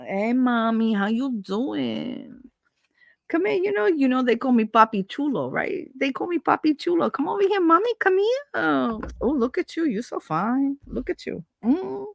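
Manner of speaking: high, sleazy flirting voice, a pitch up; catcaller voice. Retold or imitated. Catcaller flirting with a woman on the street, sleazy. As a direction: lay on the sleazy charm to a woman. Imitating a catcaller hitting on her.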